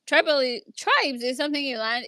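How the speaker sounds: ordinary talk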